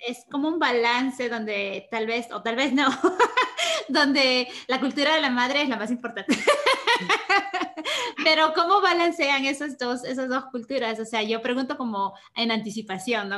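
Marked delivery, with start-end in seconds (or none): 2.9-3.8 laugh. Real, not acted. Laughing at her own joke. 6.4-8.2 laugh. Real, not acted. Laughing at her own joke. 8.2-11.0 laughing. Real, not acted. Still laughing while asking.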